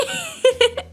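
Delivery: high laugh near tears; high cracking laugh. Real, not acted. Laughing at her own story.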